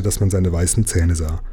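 ordinary delivery

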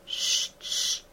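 whispered shush. A narrator's reading. Emma hushing Madame Homais in a whisper. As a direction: hush her urgently. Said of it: "fit Emma".

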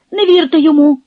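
high, squeaky plea. A narrator's reading. Peshta squeaks at the crowd, high and pleading. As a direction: squeal it out thinly. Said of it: "пропищав і Пешта".

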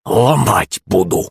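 slow, held-back threat; seething through teeth. A narrator's reading. Yulenka ready to break her tormentors, seething. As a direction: force it out slowly through clenched teeth. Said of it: "процедила она".

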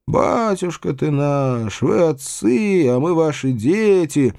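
slow, drawn-out pleading cry; rising high, long-drawn lament; peasant crowd cry. A narrator's reading. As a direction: implore like a wailing crowd. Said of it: "закричали ещё раз мужики".

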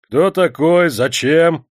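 loud, high, rude challenge; rough questioning. A narrator's reading. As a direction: demand answers roughly. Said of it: "грубо спросили мужики".

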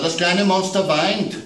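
put-on child's voice worried about monsters at bedtime; little girl. A narrator's reading. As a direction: play the small daughter scared of monsters. Child Amanda calls.

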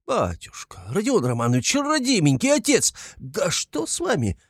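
high-pitched, fussing alarm; frightened pleading. A narrator's reading. Investigator alarmed at Raskolnikov's fit, frightened pleading. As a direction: climb into a squeak of dismay. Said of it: "в совершенном испуге Порфирий Петрович".

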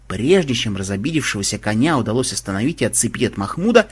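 usual speaking voice

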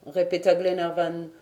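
ordinary delivery, nothing marked

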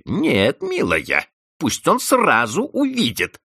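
spiteful. A narrator's reading. Housekeeper refusing Vanda, spiteful. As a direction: refuse with malice. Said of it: "злобно отвечала Анна Григорьевна".

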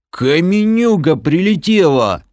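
high, loud stunned outburst; dazed confused cry. A narrator's reading. Knocked-down Bom blurts out what hit him, dazed. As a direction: blurt it out, still reeling. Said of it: "путанно объяснил он".

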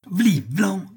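two high, swooping slap noises; imitated slaps. A narrator's reading. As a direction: voice the blows as sound effects. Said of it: "fit le doyen en interrompant Coupe en deux".

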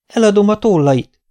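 magas hangú, rikácsoló öregasszony; rikácsold öregasszonyként; rikácsolva. A narrator's reading. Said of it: "rikácsolta az öregasszony". (öregasszonyos rikácsolás)

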